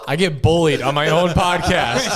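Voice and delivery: loud mock complaint through laughter; laughing complaint. Real, not acted. Host teased by cohosts, laughing loud complaint.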